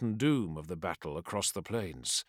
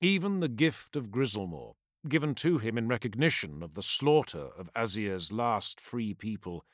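ordinary delivery, nothing marked

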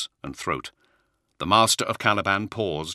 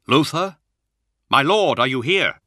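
raised, high calling voice; 9 semitones higher, 8 dB louder than usual. Acted. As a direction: call out into the dark hall. Calling out into silent cathedral.

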